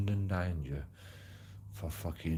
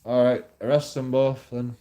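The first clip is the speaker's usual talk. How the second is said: louder, higher exasperated command; retold cop, raised and impatient; police order; 7 semitones higher, 9 dB louder than usual. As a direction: call it out like a fed-up officer. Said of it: "And it's like".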